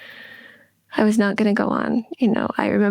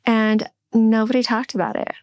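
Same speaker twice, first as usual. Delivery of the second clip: usual speaking voice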